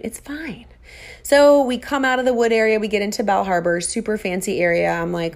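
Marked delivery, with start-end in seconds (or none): 0.0-0.7 trailing off into a soft, resigned "fine"; talking herself into it, barely audible; dropped to a mutter. Retold or imitated. Fading out while pretending all is fine.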